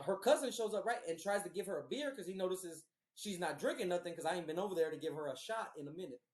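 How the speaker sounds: ordinary talk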